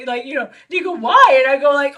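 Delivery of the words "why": loud, high incredulous why; retold challenging question. Screenwriter challenging her harsh verdict, loud and high. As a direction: fire the question back much louder.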